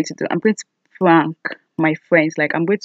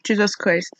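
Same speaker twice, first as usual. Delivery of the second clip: usual speaking voice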